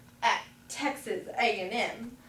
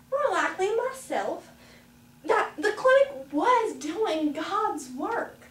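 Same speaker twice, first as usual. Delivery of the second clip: ordinary delivery, nothing marked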